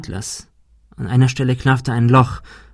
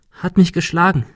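tearful confession; 7 semitones higher than usual. A narrator's reading. Monika confesses.

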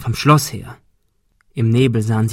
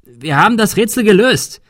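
higher, loud urgent call; pleading, raised call to the shooter; 9 semitones higher, 6 dB louder than usual. Acted. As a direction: shout it over to him. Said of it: "rief Thomas".

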